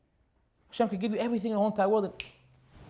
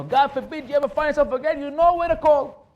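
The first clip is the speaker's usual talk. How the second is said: preaching at full force; 9 semitones higher, 6 dB louder than usual. Acted. Rabbi urging the crowd to call on God, loud. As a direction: drive the point home from the pulpit.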